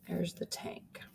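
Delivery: hushed whisper on the tank. Shown as a demonstration. Teacher showing the class the tank again, whispered. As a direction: breathe it out like a secret. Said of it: "And then again".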